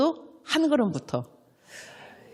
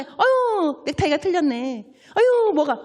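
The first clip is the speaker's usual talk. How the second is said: much higher, exasperated tut-tutting; nagging critic; 7 semitones higher, slower than usual. Retold or imitated. Fault-finding churchgoer criticizing someone's tie, high and exasperated. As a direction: scold the tie the moment you see it.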